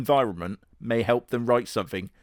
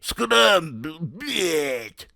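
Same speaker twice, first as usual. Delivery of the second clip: higher gruff cartoon bear voice; Mister Bear voice; 6 semitones higher, 3 dB louder, slower than usual. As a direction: scoff at the trick in a silly bear voice. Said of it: "Mister Bear is like".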